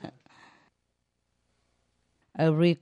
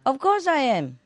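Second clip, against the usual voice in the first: indignant; 9 semitones higher, faster than usual. Retold or imitated.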